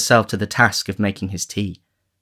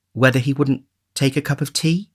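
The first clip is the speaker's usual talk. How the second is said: bashful; 5 semitones higher than usual. A narrator's reading. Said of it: "bashfully inquired".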